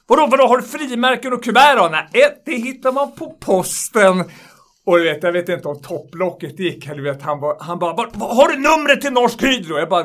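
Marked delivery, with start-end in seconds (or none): 0.1-4.2 angry customer. Retold or imitated. 7.9-9.8 angry customer. Retold or imitated. Angry customer demands number.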